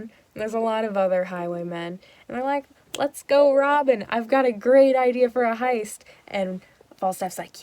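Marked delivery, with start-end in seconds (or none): 3.0-6.0 louder and higher, pumped about the heist; eager loud pitch. Retold or imitated. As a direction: sell the robbery like a thrilled crook. Highwaymen pitching a robbery.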